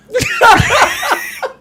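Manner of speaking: high, loud burst of laughter. Real, not acted. Guest bursting out laughing at the joke. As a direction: crack up out loud.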